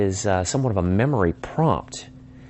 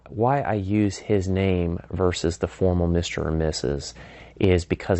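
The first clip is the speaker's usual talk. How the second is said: ordinary talk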